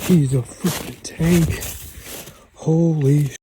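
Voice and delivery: loud, thrilled exclamations; real thrilled outburst; excited. Real, not acted. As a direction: shout it out in amazement. Hunter reaches the huge buck.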